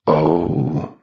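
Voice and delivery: understated, low gasp of dismay. A narrator's reading. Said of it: "gasped Mound Shroud".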